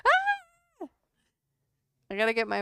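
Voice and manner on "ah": sudden high squeal; startled high yelp. Real, not acted. Startled by the dog, a sudden high yelp.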